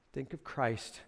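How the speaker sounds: normal talking voice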